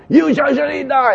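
high, stern doom declaration; stern prophecy. Acted. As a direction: pronounce the death sentence. Prophet's doom message acted.

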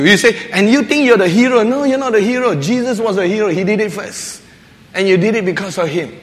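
ordinary talk, nothing marked

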